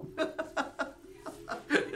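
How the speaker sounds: high, airy giggling laugh; helpless laughter at your own expense; amused laugh. Real, not acted. Laughs at daughter's changed reaction.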